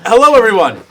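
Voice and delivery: loud, high welcome to the viewers; big show greeting. Real, not acted. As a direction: belt out the hello like a host. Opening the live show to viewers.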